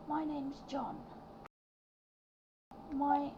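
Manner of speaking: put-on high, soft small-child voice; a shy little boy introducing himself. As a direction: youngest child telling the fish lady about his brother, high and soft. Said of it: "he'll go to you".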